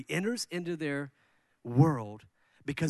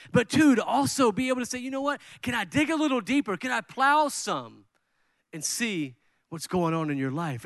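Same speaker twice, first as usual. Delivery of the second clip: rising urgency; 6 semitones higher than usual. Real, not acted. Preacher building to emphasis.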